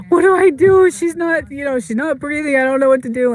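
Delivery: high, frantic plea, mostly re-enacted; retold panic. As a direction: replay the panicked call to the vet, voice shooting up. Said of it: "So I panicked".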